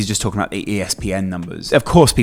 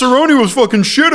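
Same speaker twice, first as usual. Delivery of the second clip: clueless fan voice; 14 semitones higher, 4 dB louder than usual. Retold or imitated. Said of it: "who go".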